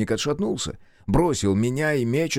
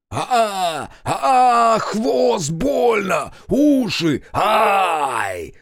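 high, loud agonized yelps; screaming in pain; 11 semitones higher, 5 dB louder, much slower than usual. A narrator's reading. Hussar with tail and ears caught, screaming in pain. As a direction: yell it across the whole valley, in pain. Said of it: "орал краснозадый на всю долину".

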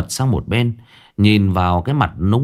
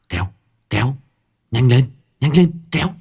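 straining effort; 5 semitones higher than usual. A narrator's reading. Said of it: "nghiến răng nghiến lợi".

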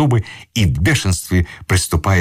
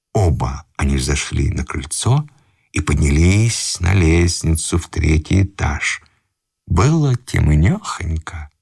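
usual speaking voice

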